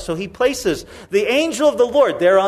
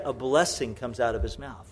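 normal talking voice

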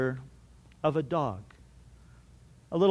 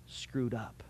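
hushed, breathy whisper; 8 semitones lower, 14 dB quieter than usual. Acted. Pastor whispers the warning to the congregation, hushed. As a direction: drop to a whisper on the words. Preacher drops to whisper for weight.

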